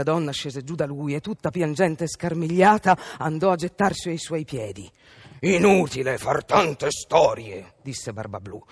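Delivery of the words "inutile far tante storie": low, slow villain voice; menacing Bluebeard. A narrator's reading. Bluebeard dismisses his wife's pleas, menacing. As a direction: pronounce it as a cold threat.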